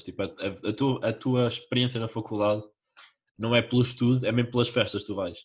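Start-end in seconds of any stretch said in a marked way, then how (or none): none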